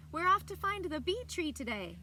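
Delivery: high, loud cheerful shout. A narrator's reading. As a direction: shout it ahead while running. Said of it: "Grandpa shouted".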